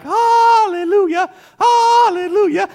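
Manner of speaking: high, slow, joyful cry of hallelujah. Retold or imitated. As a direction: sing out the relief of the light. Rejoicing out of tunnel.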